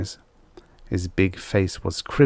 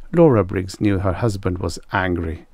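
ordinary delivery

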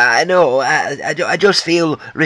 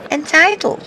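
a much higher, incredulous Entitled? thrown back; mocking echo; 11 semitones higher than usual. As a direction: echo Entitled? high and sneering. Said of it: "I know it's three syllables".

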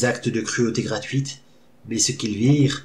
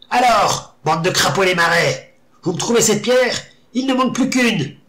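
shrill shaman; 8 semitones higher, 3 dB louder than usual. A narrator's reading. Shaman barks orders at orcs.

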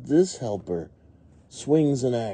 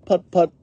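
two high, loud, short puts; tractor put-put; 11 semitones higher, 7 dB louder than usual. A narrator's reading. Farmer Bear's tractor plowing, high loud puts. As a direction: pop out tractor engine noises. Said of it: "goes Farmer Bear's tractor".

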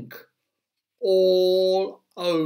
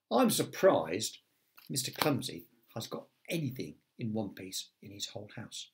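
ordinary delivery, nothing marked

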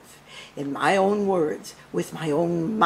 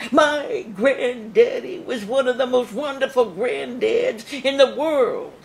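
put-on higher, slower, gushing society girl; Barrymore granddaughter; 6 semitones higher, 4 dB louder than usual. Posing as Barrymore's granddaughter, gushing and high. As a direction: gush over grandpa like a starlet. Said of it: "Maybe I could be Lionel Barrymore's granddaughter".

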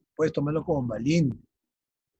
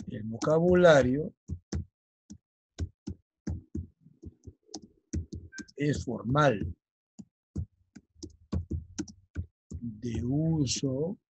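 slow, spaced-out words for note-taking; measured classroom dictation pace; much slower than usual. Shown as a demonstration. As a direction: dictate it word by word so they can copy.

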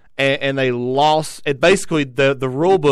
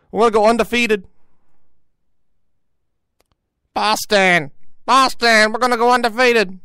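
mock Boston accent; 10 semitones higher, 5 dB louder than usual. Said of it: "don't know how the Boston accent works".

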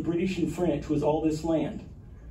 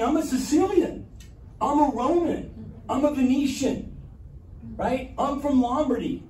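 higher, put-on voices of proud townsfolk; proud regional locals; 7 semitones higher than usual. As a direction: announce your home region with pride. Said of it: "They're saying".

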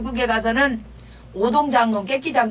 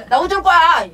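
mock plea; 7 semitones higher, 7 dB louder than usual. Beggar pleads with Sundeok.

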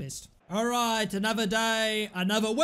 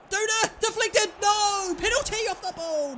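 excited yelling; 11 semitones higher than usual. Real, not acted. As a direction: cheer then cry out in protest. Chance in match, yelling.

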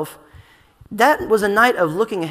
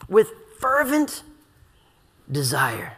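"fervent" slow and raised, long pause, "desire" dropped low; intense fervor; 4 semitones lower, slower than usual. Retold or imitated. Preacher making listeners hear Jesus' intensity, fervent and deliberate. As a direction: isolate "fervent", then let "desire" sink after a pause. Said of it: "hear the intensity of his words".

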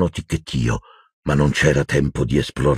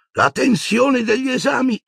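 put-on much higher, kindly old wizard. A narrator's reading. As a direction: play the doddery old examiner. (voce acuta del vecchio mago comprensivo)

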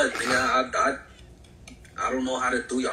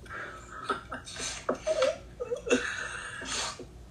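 high breathy laugh; 4 dB quieter than usual. Real, not acted. Bursts out laughing.

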